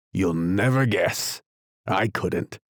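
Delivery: plain reading voice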